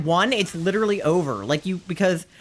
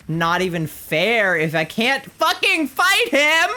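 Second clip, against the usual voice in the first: frustration climbing into a high yell; mounting outrage; 4 semitones higher than usual. Real, not acted. Player who can't fight the enemy, rising anger. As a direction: build slowly to an indignant outburst.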